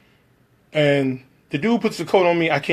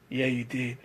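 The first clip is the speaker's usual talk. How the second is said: much softer, low-voiced aside; murmured aside; 5 semitones lower, 12 dB quieter than usual. The guy leaning over to the director, hushed. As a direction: lean in and say it under your breath.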